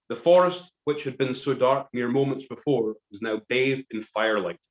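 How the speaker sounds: usual speaking voice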